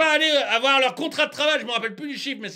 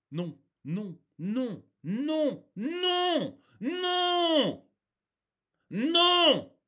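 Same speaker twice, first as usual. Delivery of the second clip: mounting refusal; 4 semitones higher, much slower than usual. Real, not acted. Ranter refuses the official step forward, building wail of no. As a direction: start tiny and climb higher with each no.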